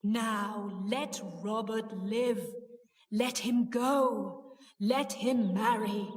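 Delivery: slow, ghostly commands; deep, drawn-out, solemn demand. A narrator's reading. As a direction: intone like a spectre giving orders. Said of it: "said in the deep voice".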